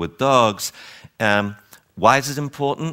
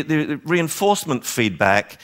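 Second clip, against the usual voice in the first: ordinary talk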